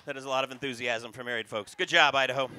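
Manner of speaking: normal talking voice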